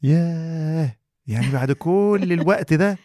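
drawn-out astonished "yah"; slow, high, wondering; amazed. Real, not acted. Amazed realization about mom's advice.

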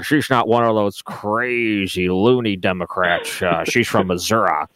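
slow, drawled Missouri twang, lightly put on; mock Missouri drawl. Host imitating the regular guy in a campaign ad, slow Missouri drawl. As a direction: ease into a folksy rural Missouri drawl. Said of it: "with the big draw".

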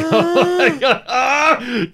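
mock lottery shock. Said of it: "and I'm like".

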